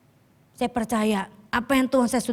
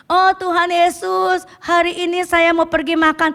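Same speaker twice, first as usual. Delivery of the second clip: higher, louder whiny excuse, slowed on "Oh"; mock lazy churchgoer; 7 semitones higher, 6 dB louder than usual. Lazy member excusing himself to Jesus, mock whiny plea.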